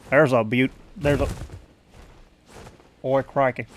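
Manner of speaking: halting Aussie accent attempts; Australian accent. Retrying to get it right, Australian accent. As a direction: try to find the Australian accent. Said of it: "I wanna do the accent right".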